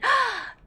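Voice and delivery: high, quick gasp of realization; sudden gasp. Real, not acted. Streamer struck by an alien theory, sharp gasp. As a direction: gasp as the theory hits you.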